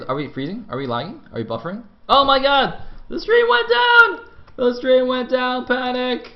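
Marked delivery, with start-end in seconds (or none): 2.1-4.3 very high, loud, hammed-up shriek; mock panic. Caster seeing the stream freeze, mock-panicked shriek. 4.6-6.3 high, loud, drawn-out alarm; slow hammed-up cry of disaster; mock panic. Caster announcing the lost stream, mock panic.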